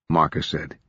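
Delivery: plain reading voice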